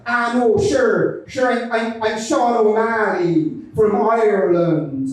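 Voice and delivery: put-on Irish accent, drawn out at the end. Mystery man introduces himself to the group, mock Irish accent. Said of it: "who was doing an Irish accent".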